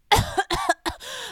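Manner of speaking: hammed-up cough; high, put-on coughing fit; fake cough. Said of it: "I'm choking and coughing all over".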